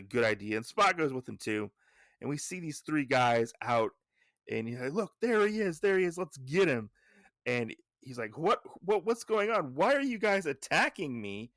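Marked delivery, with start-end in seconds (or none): 4.9-6.9 high-pitched, urgent acted shout to accomplices; rally the gang to pounce; raised voice, quick rallying cry; attackers calling out. Attackers spot their target. 8.4-11.5 high, raised voice of acted alarm; pitched-up, incredulous questioning; bewildered protest. Hero suddenly attacked, demands why.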